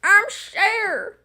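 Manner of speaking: a hint of [name], breaking into a high laugh; [name] impression. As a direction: try a [name] voice and crack up. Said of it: "my best attempt at a [name] impression".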